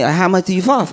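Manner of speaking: "floss" lisped, like "flath"; lisping dentist. Retold or imitated. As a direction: lisp the question again. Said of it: "it's making him lisp".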